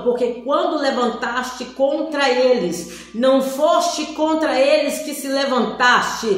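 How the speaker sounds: solemn booming voice of God, very slow. Retold or imitated. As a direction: thunder each word like the Lord rebuking her. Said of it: "Aquela voz de autoridade".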